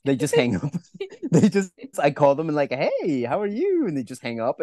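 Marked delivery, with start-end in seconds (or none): 2.7-3.9 high, sing-song phone hello; mock chirpy greeting. Cold caller greeting a stranger, exaggeratedly chirpy. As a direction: put on an over-friendly caller, pitched way up.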